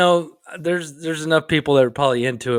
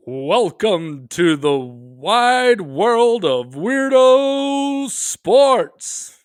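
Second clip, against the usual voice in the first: booming, drawn-out announcer intro; slow, high ring announcer call; fighting game announcer; 6 semitones higher, 3 dB louder, much slower than usual. Pretend. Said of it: "Do you need me to do the announcer voice thing?".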